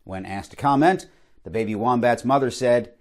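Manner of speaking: usual speaking voice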